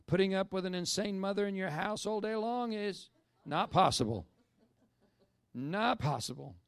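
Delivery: high and loud, slamming down on possible; loud insistence. Real, not acted. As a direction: pound the pulpit on not possible. Preacher insisting loudly.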